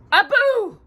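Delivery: high loud call across the snow. Acted. Aladdin calls for his lost monkey, high loud shout. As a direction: call out to find him. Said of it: "he called".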